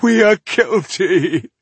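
high, loud, drawn-out wail; sobbing. A narrator's reading. Rebel confessing to the elf, sobbing.

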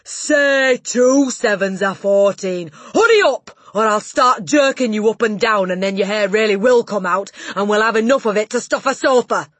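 loud, high, threatening tirade; bully with full-volume shouted orders; bellowing Trunchbull. A narrator's reading. Headmistress threatening the dangling boy, furious bellowing. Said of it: "bellowed the Trunchbull".

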